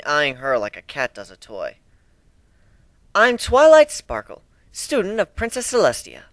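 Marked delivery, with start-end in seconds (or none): 3.1-6.2 put-on higher, earnest young mare introducing herself; Twilight Sparkle voice. Introducing herself to the new crew, earnest pony voice.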